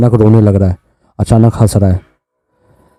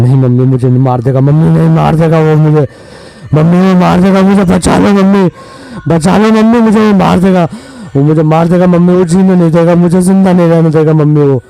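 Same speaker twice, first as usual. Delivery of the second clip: put-on a boy possessed, begging mummy to save him; high, quick haunted voice crying to mother; eerie frightened pleading; 8 semitones higher than usual. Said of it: "खौफनाक आवाज़ें आ रही थी".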